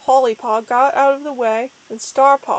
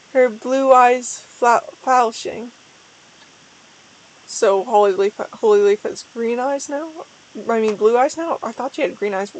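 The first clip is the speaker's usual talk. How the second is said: usual speaking voice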